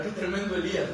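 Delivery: ordinary talk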